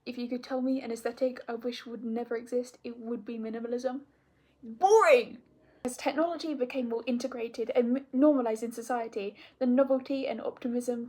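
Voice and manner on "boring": loud, higher scornful jab; mock disgust. Real, not acted. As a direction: spit out the word with disdain.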